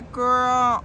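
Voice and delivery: normal talking voice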